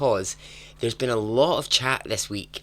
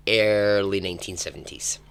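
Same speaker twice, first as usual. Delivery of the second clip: ordinary delivery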